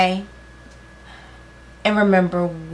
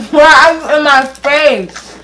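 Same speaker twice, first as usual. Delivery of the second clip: loud laughing protest; 6 semitones higher, 12 dB louder than usual. Real, not acted. Friend in her face.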